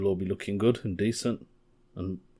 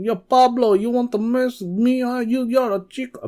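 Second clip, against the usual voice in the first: hammed-up Mexican accent pitched way up; 6 dB louder than usual. Comedian imitating a Mexican accent to comics at the gig. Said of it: "I started doing all this Mexican accent".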